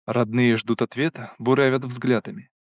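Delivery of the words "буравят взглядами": ordinary delivery, nothing marked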